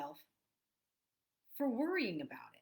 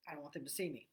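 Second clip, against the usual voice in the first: self-conscious; 7 semitones lower, 6 dB quieter, faster than usual. Retold or imitated. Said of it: "you might get a little".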